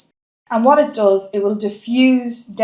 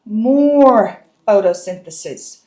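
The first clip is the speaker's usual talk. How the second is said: "more" drawn out long and loud; stress on "more"; 5 dB louder, slower than usual. Real, not acted. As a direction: lean hard on "more", stretching it out before "photosynthesis". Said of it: "I'm emphasizing the word more".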